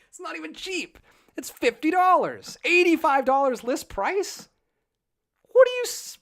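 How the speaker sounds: high, loud, incredulous outburst; outraged disbelief. Real, not acted. Host ranting at Disney over the price, outraged.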